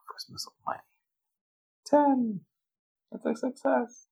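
1 squeaky exasperated voice. Acted. Player reacting to a roll.

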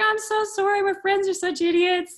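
high-pitched pleading apology; mimicking an over-sorry guy; effusive apology. Guy apologizes in retold dialogue.

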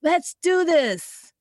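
playful hype voice for her eager body; pumped-up body. Retold or imitated. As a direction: rev up like you're ready to go. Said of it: "My body is like".

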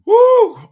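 loud high woo; loud whoop. Retold or imitated. Telling the audience how bad it got, a loud high whoop. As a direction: let out a big whoop of disbelief.

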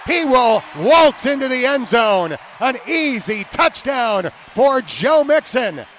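excited touchdown call. Real, not acted. Announcer calling an easy touchdown, excited shout. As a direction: call the score with big, stretched words.

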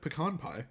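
"pecan" as puh-KAHN in "pecan pie"; "pecan" with open ah before pie; preferred pronunciation. Shown as a demonstration. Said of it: "I still think of it as".

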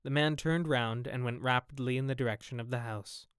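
plain reading voice

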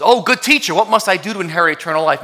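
high, loud, fast plea of a kneeling man; pleading rich man. Acted. Rich man on his knees begs Jesus, high and pleading. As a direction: beg the teacher, voice lifted and rushed.

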